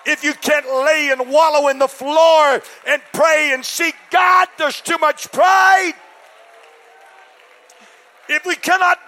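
loud, high-pitched preacher's shout; full-throated sermon shout; preaching at full force. Real, not acted. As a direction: thunder it from the pulpit.

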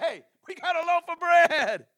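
high, slow, laughing mock announcement. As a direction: sing out the news with a laugh, mocking. Mocking disciple's bread announcement.

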